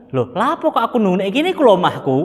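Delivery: puzzled and a little put out; bewildered host. Retold or imitated. A Yemeni man baffled about being told to wait at his own home, confused. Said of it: "Orang Yaman ini bingung".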